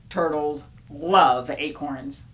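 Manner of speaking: ordinary delivery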